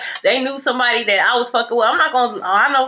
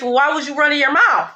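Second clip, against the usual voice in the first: ordinary talk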